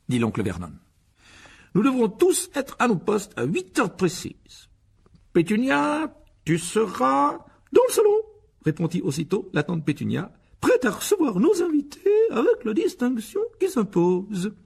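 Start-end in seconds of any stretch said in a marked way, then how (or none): 1.7-8.1 high, loud, slow instructions; bossy pompous uncle. Acted. Uncle making the family rehearse the dinner plan, pompously bossy. 10.6-14.5 very high, slow, loud society voice; affected, eager aunt. Acted. Aunt reciting her part as hostess, affectedly refined.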